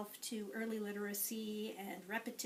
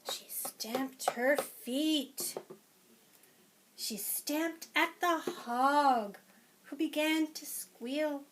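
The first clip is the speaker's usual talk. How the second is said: lively, sing-song picture-book read-aloud; reading to children; 4 semitones higher, 6 dB louder, slower than usual. Acted. Teacher reading a picture book to preschoolers, lively and sing-song. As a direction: read it to a class of little ones.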